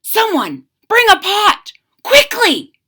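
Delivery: high, loud hurried command; urgent order. A narrator's reading. Mayor ordering villagers to fetch a pot, high and loud. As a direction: order them to hurry.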